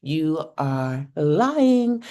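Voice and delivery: every word slow and deliberate; slow emphatic. Real, not acted. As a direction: spell it out, one word at a time. Emphatic call-out to listeners.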